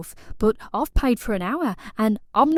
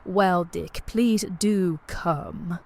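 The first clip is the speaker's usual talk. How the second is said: put-on posh English accent; 3 semitones lower than usual. Client playing a posh lady greeting her plumber, posh accent. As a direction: play it quite posh, like a well-to-do lady. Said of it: "Did you like my accent".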